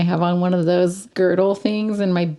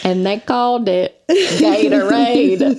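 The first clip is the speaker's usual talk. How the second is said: lightly voiced ad scientist, stretched out; slow mock commercial line, laughing around it; 3 semitones higher, 4 dB louder, slower than usual. Kid-style quoting an old Gatorade commercial, hint of scientist voice. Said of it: "like, the scientist guy".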